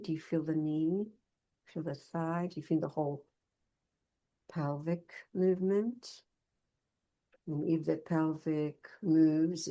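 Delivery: soft, unhurried meditation guidance; guided movement meditation. Acted. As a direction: gently lead them through body awareness, leaving pauses.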